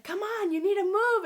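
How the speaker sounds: high, loud motherly urging; retold yelling. Mom pushing daughter to move, retold yell. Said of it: "And she's yelling at me".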